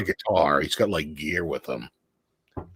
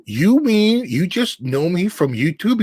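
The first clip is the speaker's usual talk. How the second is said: incredulous question; 10 semitones higher, 4 dB louder, slower than usual. Retold or imitated. Stunned at being recognized.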